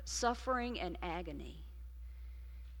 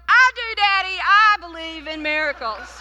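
put-on high, loud little-kid voice answering daddy; child calling out to her preacher father; four-year-old child; 8 semitones higher, 15 dB louder than usual. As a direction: pipe up eagerly like a small child in church. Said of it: "I raise my hand and go".

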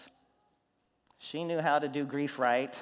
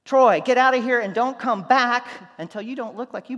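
louder, higher mock scolding; blunt order; 8 semitones higher, 13 dB louder than usual. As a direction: bark him out of the room. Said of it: "said to me".

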